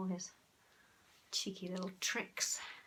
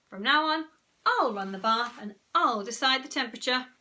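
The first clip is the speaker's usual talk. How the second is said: louder, firm mother laying down the law; bossy Mum; 4 semitones higher, 8 dB louder than usual. A narrator's reading. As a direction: announce the rules like a strict mum. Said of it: "said Mum".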